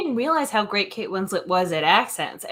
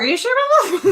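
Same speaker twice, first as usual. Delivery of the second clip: high-pitched, incredulous challenge; mock disbelief; 12 semitones higher, 4 dB louder, much faster than usual. Co-host playfully doubting a friend's take on a show, mock disbelief. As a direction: question it in a high, teasing voice. Teasing retort quoting own doubtful reaction.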